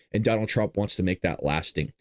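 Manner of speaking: ordinary talk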